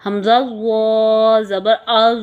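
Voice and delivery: ز in زو drawn long and full; teacher drilling زو with a full heavy sound; slow spelling drill. Shown as a demonstration. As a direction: spell it slowly, letter by letter. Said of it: "تو کو پر کرتے ہوئے".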